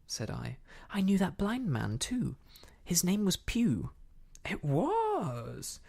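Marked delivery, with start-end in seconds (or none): 0.9-3.9 put-on louder, higher-pitched boy's report; eager confirmation. Boy eagerly confirms the blind man. 4.4-5.8 high, drawn-out excited exclamation; excited cry of agreement; quite excited. A narrator's reading. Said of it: "now quite excited".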